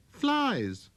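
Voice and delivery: put-on a curious boy calling out; little prince. Prince voiced.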